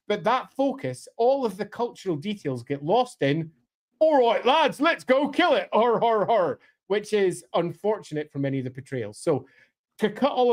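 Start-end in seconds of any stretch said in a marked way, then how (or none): 4.0-6.6 high, rowdy British lad voice; mock greenskin lad. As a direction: ham up a rowdy brute rallying the lads. Mocks greenskin portrayals with stock voice.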